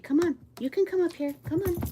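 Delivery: high, loud coaxing call to a dog. Real, not acted. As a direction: coax the dog up onto the bed, bright and high. Calling her dog Hachi up.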